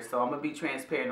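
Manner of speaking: ordinary talk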